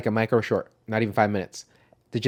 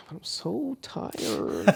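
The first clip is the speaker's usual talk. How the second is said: higher, very slow, softer tired moan; half-hearted sleepy complaint; mock exhausted whine; 6 semitones higher, 10 dB quieter, much slower than usual. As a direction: drag it out like you can barely stay awake. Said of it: "I'm just like".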